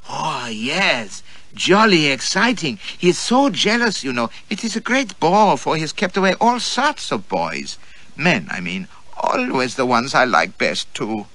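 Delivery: put-on high, eager, teasing girl's voice.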